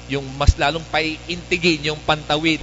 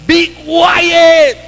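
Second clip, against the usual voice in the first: high loud hushing shout; crowd shouting; 13 semitones higher, 12 dB louder than usual. Acted. Crowd shouts the blind man down, loud. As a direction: bark at him to shut up. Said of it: "Anong sigaw sa kanya?".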